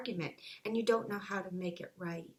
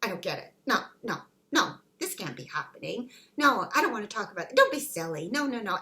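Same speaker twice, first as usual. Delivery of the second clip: mock panicked refusal, rushed and louder; denial at divorce news; 6 dB louder, faster than usual. Spouse hearing "I want a divorce", refusing in a rush. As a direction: act out the stunned spouse waving it away. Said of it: "You go".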